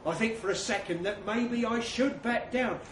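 ordinary delivery

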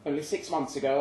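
plain reading voice

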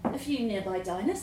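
ordinary delivery, nothing marked

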